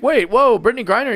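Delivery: mock alarmed objection. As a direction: cut in like a scandalized onlooker. Acting internet's objection to remark.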